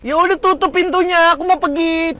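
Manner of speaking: loud, high-pitched retort; angry dismissal. Acted. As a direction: send them off heatedly. Quarrel, he sends her off.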